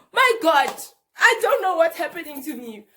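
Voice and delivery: loud high alarmed cry; shouting. Retold or imitated. Caught in a trance in front of everyone, loud alarmed shout. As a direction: shout it out, overwhelmed. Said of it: "I'm just shouting".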